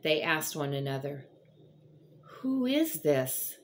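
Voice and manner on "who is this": slow, higher, awestruck question; awed amazement. Retold or imitated. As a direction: let the wonder slow the words down. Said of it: "In fear and amazement".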